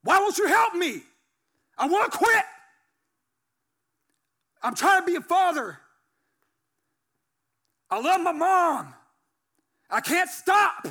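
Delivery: high, very loud cry out to God; re-enacted anguished shouting; angry yelling. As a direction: yell desperately at God, mad and pleading. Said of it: "And I'm yelling and I'm cussing".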